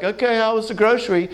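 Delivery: high, sing-out mom greeting; imitated cheery mom. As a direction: ask like a mom welcoming a child home. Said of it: "Mom's like".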